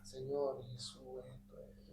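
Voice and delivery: very soft praying under the breath; murmured prayer. Retold or imitated. Uneasy passenger praying to Jesus on the bus, murmured. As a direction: re-enact a private prayer, fading to a whisper. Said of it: "Yo comienzo a orar".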